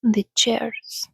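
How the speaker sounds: ordinary delivery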